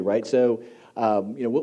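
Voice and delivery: ordinary talk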